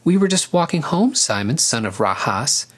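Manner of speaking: starts high, settles by the name; high-pitched casual call; calling over shoulder. A narrator's reading. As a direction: toss it back to someone behind you. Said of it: "she called over her shoulder".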